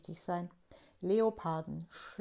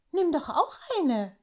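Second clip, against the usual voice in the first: grinning invitation; 7 semitones higher, 5 dB louder than usual. A narrator's reading. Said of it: "fordert er Tafiti auf und grinst".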